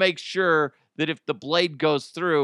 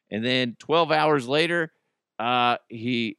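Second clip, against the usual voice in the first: usual speaking voice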